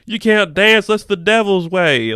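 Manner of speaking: hammed-up scolding prude forbidding dancing; mock preachy townsfolk. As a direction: mimic a scolding prude with scorn. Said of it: "a bunch of dicks just acting like".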